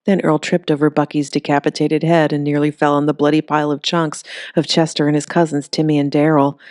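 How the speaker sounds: usual speaking voice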